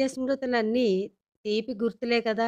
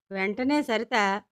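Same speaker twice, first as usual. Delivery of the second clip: ordinary delivery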